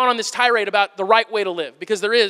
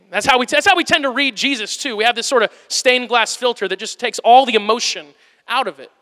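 ordinary delivery

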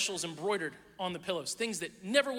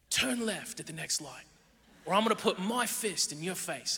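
hushed, threatening British gangster impression; Jason Statham; 2 semitones lower, 3 dB quieter than usual. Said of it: "always whispering, but also always threatening you".

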